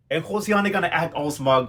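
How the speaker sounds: ordinary delivery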